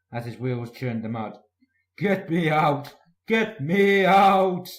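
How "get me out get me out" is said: slow, loud, rising desperate cry; panicked plea. A narrator's reading. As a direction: wail for rescue from the mud. Gordon stuck in a ditch.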